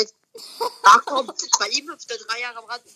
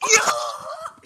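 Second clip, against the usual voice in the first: huge high cheer; triumphant shout. Real, not acted. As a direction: yell with joy at the goal. Scoring a goal.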